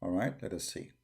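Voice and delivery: turned-down voice for a level check. Real, not acted. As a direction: speak quietly to balance the microphones. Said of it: "I will lower my voice".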